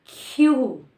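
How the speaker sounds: queue said slowly as kju; drawn-out demo. Shown as a demonstration. Teacher modelling queue slowly for students. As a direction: stretch out queue for learners. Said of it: "it is used queue".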